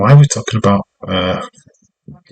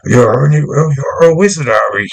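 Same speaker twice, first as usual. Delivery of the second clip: higher, louder, slower Hagrid voice; Hagrid impression; 5 semitones higher, 3 dB louder than usual. Streamer quoting Hagrid to the wrestler, hammed-up Hagrid voice. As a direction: do Hagrid telling Harry the news. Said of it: "Hagrid voice".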